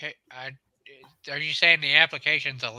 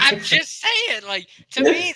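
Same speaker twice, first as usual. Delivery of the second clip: laughing, high; 9 semitones higher, 5 dB louder than usual. Real, not acted. Laughing defense after insult.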